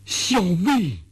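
high, astonished outburst with pauses; excited exclamation. A narrator's reading. Orderly gushing at the magistrate after a long stunned silence, excited. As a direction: cry out in rising, overjoyed disbelief. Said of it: "半晌才激动地说".

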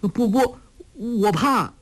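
put-on young girl pleading in fear; anxious plea. A narrator's reading. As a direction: beg urgently, scared. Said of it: "黄蓉急着说".

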